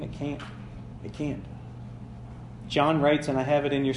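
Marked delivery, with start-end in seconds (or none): 0.0-1.4 much softer, firm "It can't."; hushed, certain "It can't"; soft firm denial. Real, not acted. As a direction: say it low and final. Quiet emphatic answer.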